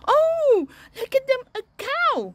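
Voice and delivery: very high, loud, quick excitement; delighted surprise. Acted. Teacher shows children a cow, excited. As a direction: gasp out a thrilled discovery.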